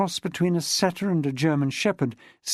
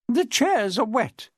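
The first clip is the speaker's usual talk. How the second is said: higher, slow prim objection; objecting; 7 semitones higher, slower than usual. Acted. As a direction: object primly. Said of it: "Miss Chilmark objected".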